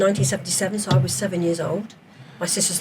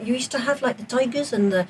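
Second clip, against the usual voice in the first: tall tale told in a put-on South African accent, rising higher; mock South African accent; 4 semitones higher than usual. Student at college fooled with a fake South African accent. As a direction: spin a wild yarn in a hammed-up South African accent. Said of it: "I put on my South African accent".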